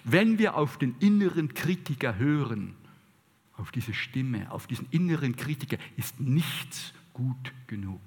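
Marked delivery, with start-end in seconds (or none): none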